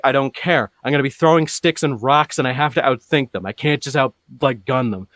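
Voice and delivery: acted-out defiant comeback; emphatic retort. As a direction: push back emphatically, easing off slightly.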